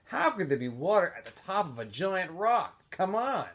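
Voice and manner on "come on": ordinary delivery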